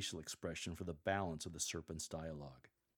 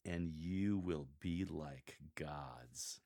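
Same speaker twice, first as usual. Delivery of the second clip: very slow, much lower and softer "And you will be like gods."; serpent's slow, lowered promise to Eve; very slowly; 6 semitones lower, 7 dB quieter, much slower than usual. Shown as a demonstration. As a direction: stretch every word. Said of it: "Speak very slowly when you read".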